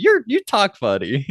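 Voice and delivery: high, slowed little-girl imitation; childlike voice, pitched well up; young Buffy. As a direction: say it like a puzzled small child. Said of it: "when she's talking to young Buffy".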